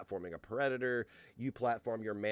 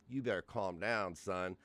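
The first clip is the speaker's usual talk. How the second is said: ordinary talk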